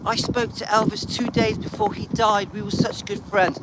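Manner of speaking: a touch of sugary ex-wife; mimicking her claim of friendship, lightly done; mocking Priscilla. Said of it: "She says".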